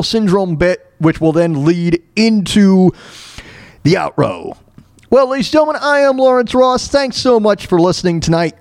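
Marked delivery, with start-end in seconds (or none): 5.1-8.5 raised, ringing announcer voice; announcer sign-off. Acted. Host signing off to listeners, announcer voice.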